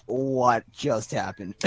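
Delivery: very low, slow, hushed question; stunned bewilderment. Acted. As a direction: stand there dumbfounded and ask it slowly. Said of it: "He's just standing there like".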